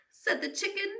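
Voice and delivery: ordinary delivery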